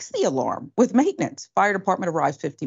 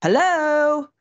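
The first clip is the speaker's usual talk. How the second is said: high, drawn-out mock shout; 7 semitones higher, much slower than usual. Host retelling a lady on the P A system, mock shouting. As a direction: imitate someone shouting into a PA, pitched high.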